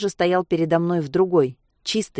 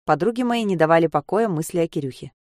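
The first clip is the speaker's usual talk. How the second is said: ordinary delivery, nothing marked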